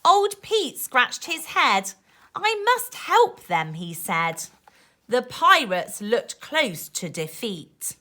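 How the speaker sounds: put-on lively rhyming read-aloud for kids; reading to children. A narrator's reading. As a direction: bounce the rhymes like a bedtime book. Reading rhyming picture book aloud.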